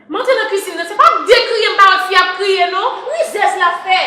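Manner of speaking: high, loud, half-laughing shriek; hysterical outburst. Acted. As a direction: burst out laughing and shouting, pitch way up. Sister explodes, laughing shrill shouting.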